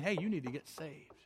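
low, soft urging; quiet inner prompting. Acted. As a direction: speak it softly as an inner voice. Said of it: "the Holy Spirit saying".

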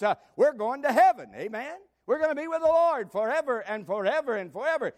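high, ringing revival preaching; fiery gospel preacher proclaiming salvation; full-force preaching. Acted. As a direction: lift the church up to heaven. Preacher exhorting the congregation.

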